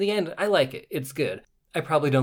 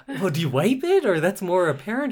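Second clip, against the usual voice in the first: imitating flustered young man; 6 semitones higher, 4 dB louder, faster than usual. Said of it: "and I was like".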